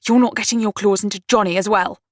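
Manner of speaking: hostile. A narrator's reading. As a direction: warn a rival off your brother. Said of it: "the naked hostility took her aback".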